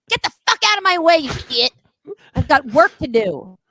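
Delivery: high, loud mock-furious yell; angry clown outburst. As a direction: blow up at him as the bossy clown. Clown rebuffs boy's dance invitation.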